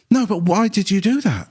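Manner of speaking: a hint of exasperated hospital staff; staff pressing. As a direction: press the question, mock-outraged. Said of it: "And they go".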